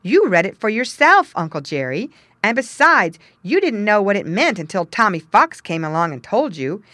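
loud, firm refusal; firm declaration. Acted. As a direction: stand your ground loudly. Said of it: "he declared".

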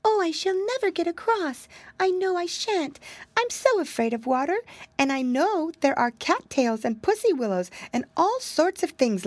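put-on high, fretful little girl voice; a tiny frightened mouse wailing; timid mousy girl. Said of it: "he heard a voice saying".

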